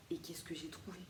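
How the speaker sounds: teasing whisper. Real, not acted. Building suspense for viewers before the golden seahorse, whispered. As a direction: whisper it like a secret reveal.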